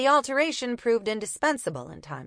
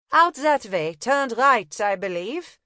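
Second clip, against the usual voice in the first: put-on higher, louder German-accented directions; 4 semitones higher, 6 dB louder, slower than usual. Una sending the copper off, German accent. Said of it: "feigning a German accent".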